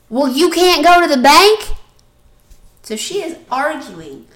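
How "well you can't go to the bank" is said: much louder, higher sarcastic retort; incredulous outburst. Retold or imitated. Sarah snapping at the cashier about the bank. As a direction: throw it back at her in disbelief. Sister arguing with clerk.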